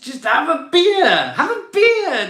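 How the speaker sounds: a cheery laughing call to just have a beer; excited laughing. Real, not acted. Host urging stressed viewers to relax, laughing and excited.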